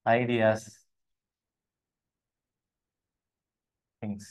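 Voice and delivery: each item alone, long pauses between; low, spaced-out listing; word-by-word dictation. Shown as a demonstration. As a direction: dictate the list slowly for note-taking.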